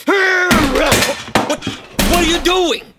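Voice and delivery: high, sharp alarm. Acted. Coworker watching laptop smashed, high alarmed cry. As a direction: stop him in panic.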